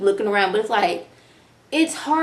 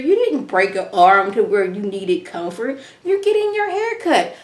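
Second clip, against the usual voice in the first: incredulous plea to Doug; 5 semitones higher than usual. Retold or imitated.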